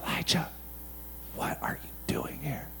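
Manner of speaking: barely audible, low and unhurried; still small voice. Retold or imitated. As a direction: whisper it gently, taking your time. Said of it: "in the still small voice".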